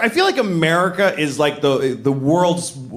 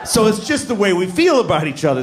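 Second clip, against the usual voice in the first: ordinary talk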